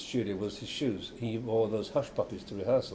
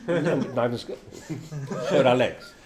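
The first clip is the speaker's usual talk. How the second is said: slow, laughing it out; giggling through the memory; real laughter, louder at the end; 4 semitones higher, 5 dB louder, slower than usual. Real, not acted. Laughing about companions in skirts.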